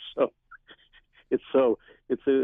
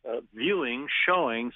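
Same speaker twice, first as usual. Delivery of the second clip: ordinary delivery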